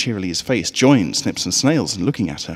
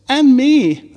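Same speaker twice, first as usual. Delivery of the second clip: tiny filly voice; 9 dB louder than usual. A narrator's reading. Third filly in bag.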